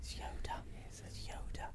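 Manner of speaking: breathy mystic whisper. Acted. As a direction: whisper it like a secret. Whispered Yoda intro.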